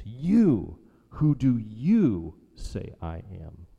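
YOU thrown to the front, loud and stretched; slow, emphatic stress on YOU. Shown as a demonstration. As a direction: punch each "you" hard. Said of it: "It's emphatic".

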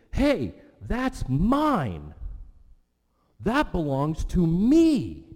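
high, loud, drawn-out MINE and ME; really emphatic. Acted. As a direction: kid yelling at a sibling over toys. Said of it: "becomes really emphatic".

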